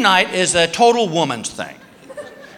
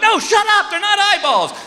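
frustrated shout; 13 semitones higher than usual. Retold or imitated. Husband yelling at wife over drawing, exasperated. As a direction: snap back in frustration. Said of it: "I'm like".

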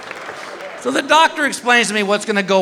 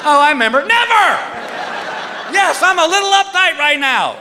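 comic panic; 10 semitones higher than usual. Retold or imitated. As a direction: sputter in mock alarm.

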